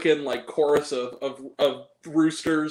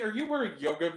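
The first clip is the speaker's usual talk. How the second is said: ordinary talk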